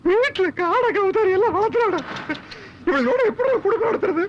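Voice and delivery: high-pitched comic wailing; childlike crying. Acted. Husband lamenting family life to his companion, childlike crying. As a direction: bawl the complaint like a small child. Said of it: "சின்ன பிள்ளை மாதிரி அழுதுகிட்டு".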